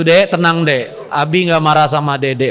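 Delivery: calm her gently as she cries; soothing husband. Retold or imitated. Husband soothing crying wife.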